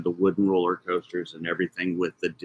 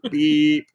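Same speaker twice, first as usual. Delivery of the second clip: a high, loud bleep sound covering a word; mock censor beep; 8 semitones higher, 7 dB louder than usual.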